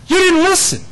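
high, loud rebuke; rebuking. Pretend. God rebuking Israel repeatedly.

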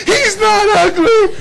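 very high, loud mock wail; hammed-up indignant cry, slow and high-pitched; outraged new mother. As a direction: imitate a furious mother shrieking in protest. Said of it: "Steam shot out of Marie's ears".

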